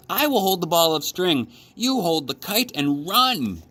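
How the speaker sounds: excited frog full of hope; bright, chirpy storybook Frog; Frog's voice. A narrator's reading.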